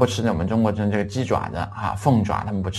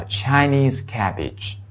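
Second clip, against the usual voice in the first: slow model word; 5 semitones higher, much slower than usual. Shown as a demonstration. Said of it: "大白菜叫Chinese cabbage".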